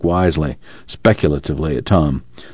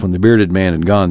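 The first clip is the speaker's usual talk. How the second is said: usual speaking voice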